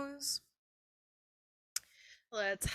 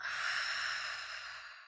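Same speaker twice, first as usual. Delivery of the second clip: forceful unvoiced exhale scream; whisper scream; 4 dB quieter than usual. Shown as a demonstration. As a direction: fog a mirror with a breathy scream. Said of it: "Really opening the mouth up wide as you take that exhale".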